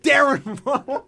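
laughing. Real, not acted. Laughing at the wrong name.

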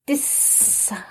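breathy whispered retry. Real, not acted. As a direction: whisper the word to yourself.

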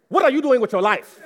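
high, loud mock-motherly outburst; exasperated mock demand. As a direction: shout it like a fed-up parent. Translates his mother's message, comically.

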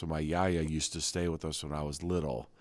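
ordinary talk

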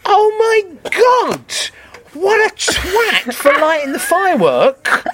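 high, drawn-out mock horror; imitated crowd outrage. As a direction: slowly cry out like an appalled audience. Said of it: "the whole room just went".

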